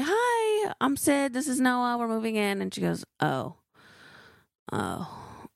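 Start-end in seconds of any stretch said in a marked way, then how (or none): 0.0-2.6 high sing-out hello, easing down as it goes; bubbly introduction to a new neighbour; cheerful greeting. Retold or imitated. 3.2-5.5 ominous reaction. Retold or imitated. Neighbour's ominous reaction, retold.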